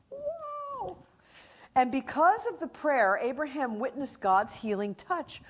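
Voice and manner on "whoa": a sudden high, soft whoa of wonder; amazed whoa. Real, not acted. Preacher marvels at prayer, soft awed whoa. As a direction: breathe it out in awe.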